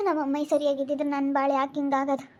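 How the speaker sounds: ordinary talk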